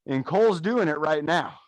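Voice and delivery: normal talking voice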